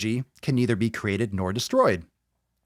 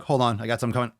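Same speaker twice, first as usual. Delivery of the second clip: ordinary talk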